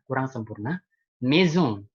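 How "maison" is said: "Maison" with a Z sound and a nasal ending; correct French pronunciation. Shown as a demonstration. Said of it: "c'est correct".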